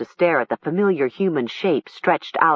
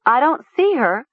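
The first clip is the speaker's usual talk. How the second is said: worried; 8 semitones higher, 3 dB louder than usual. A narrator's reading. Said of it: "Nessa said".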